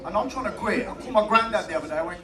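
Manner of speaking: ordinary talk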